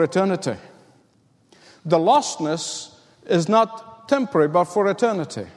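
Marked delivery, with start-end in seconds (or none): none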